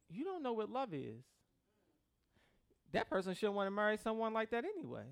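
usual speaking voice

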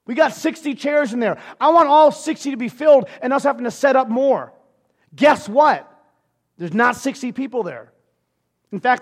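loud preaching, building. Acted. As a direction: preach it to the room.

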